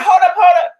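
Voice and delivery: high, loud imitation of husband objecting; mock protest. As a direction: cut in loudly as the alarmed husband. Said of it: "He was like".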